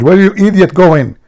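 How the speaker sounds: high, loud scolding yell; mock furious wife hollering orders; screaming wife. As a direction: yell at your husband in a high voice. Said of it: "His wife, she screamed".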